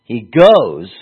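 "goes" hit hard and loud; weighty reading that punches "goes"; stress on goes. Shown as a demonstration. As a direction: lean heavily into "goes", then pause. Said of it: "read it like this in English".

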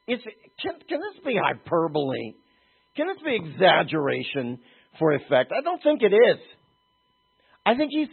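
impassioned preaching. Acted. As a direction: drive the point home to the congregation.

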